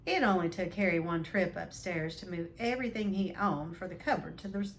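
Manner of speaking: plain reading voice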